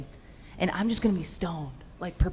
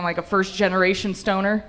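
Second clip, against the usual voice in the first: usual speaking voice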